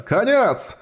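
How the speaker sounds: high, wailing repeat of the word; wailing despair. Acted. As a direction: cry it out in comic panic.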